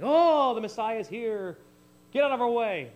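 high, loud acted cry of a revolutionary; crying it out to the streets; mock proclaiming aloud. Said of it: "proclaim out loud with crying".

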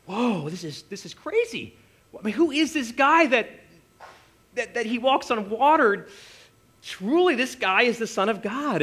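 high, wondering whoa; amazed disciples. Retold or imitated. Disciples marvelling at Jesus walking on water. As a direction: let the awe rise to a realization.

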